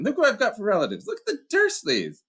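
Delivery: put-on a young boy cheering up a friend, pitched high; Harry, boyish voice. Harry to Hagrid, high boyish encouragement.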